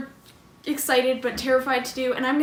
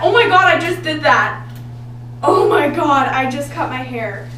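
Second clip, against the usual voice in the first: loud shocked disbelief; shocked shout; 9 dB louder than usual. Real, not acted. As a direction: yell it in horrified shock. Shocked at first cut.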